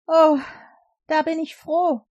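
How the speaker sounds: a loud, high "Oh" of relief; relieved joy. Acted. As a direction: burst out in relief. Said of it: "rief König Darius". (erleichterter, froher Ausruf, laut und hoch)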